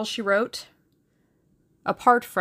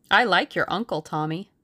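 usual speaking voice

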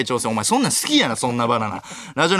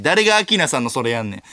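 normal talking voice

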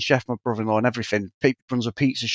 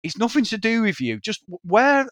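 a hint of a dad snapping at his daughter, higher; mock scolding, lightly voiced; telling her off; 7 semitones higher than usual. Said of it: "he was having a go at her going".

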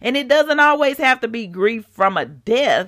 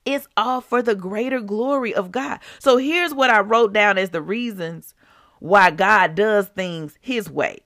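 ordinary delivery

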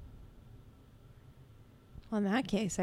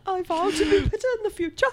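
higher, laughing slide into Irish; failed Irish accent; 12 semitones higher, 6 dB louder, faster than usual. Retold or imitated. Cohost imitating the apology in Irish accent. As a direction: try the voice, slip into Irish. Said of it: "It went right into Irish".

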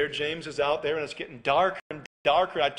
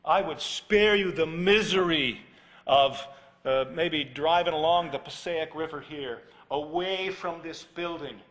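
ordinary delivery

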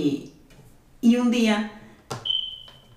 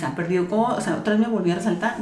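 ordinary talk

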